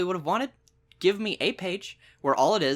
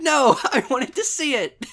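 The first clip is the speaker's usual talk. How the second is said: laughing protest; 8 semitones higher, 4 dB louder than usual. Retold or imitated. Whining protest at the author.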